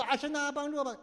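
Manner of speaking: half-hearted sob to God; mock crying prayer. Believer crying to God for help, mock weepy. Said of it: "你没事就哭".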